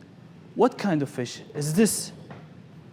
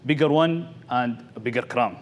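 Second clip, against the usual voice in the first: normal talking voice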